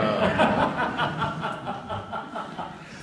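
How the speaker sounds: a high, loud laugh falling off; loud burst of laughter. Real, not acted. Laughs at own joke.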